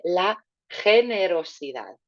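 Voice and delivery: generosidad with a throaty Spanish j sound on the G; Spanish G from the throat. Shown as a demonstration. Teacher models generosidad for class, throaty G. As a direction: say generosidad with the G rasped at the back of the throat. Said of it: "put it into the back of our throat".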